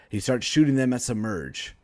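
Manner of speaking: usual speaking voice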